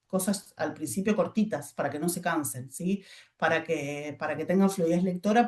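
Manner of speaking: usual speaking voice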